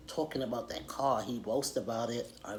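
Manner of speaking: usual speaking voice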